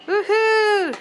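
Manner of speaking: high loud woohoo; cheer. Real, not acted. Mother cheering her daughter home from work, loud high whoop. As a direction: whoop with joy.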